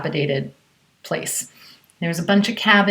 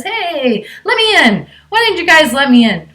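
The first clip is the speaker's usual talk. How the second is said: much higher, louder insistent demand; demanding stranger; 9 semitones higher, 7 dB louder than usual. Retold or imitated. Stranger outside the cabin demanding to be let in, loud. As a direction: bang on the door and demand in.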